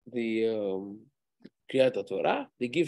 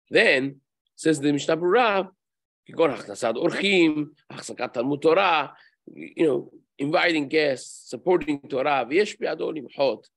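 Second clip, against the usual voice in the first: text reading; 2 semitones higher, 5 dB louder, faster than usual. Acted. Reads Mishnah Berurah aloud.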